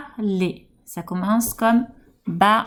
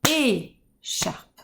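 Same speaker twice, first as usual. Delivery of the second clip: "écharpe" with the first syllable held, then a gap; "écharpe" cut after its opening é; 4 dB quieter than usual. Shown as a demonstration. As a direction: tap out "écharpe" in two beats. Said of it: "Quand je tape ma première syllabe".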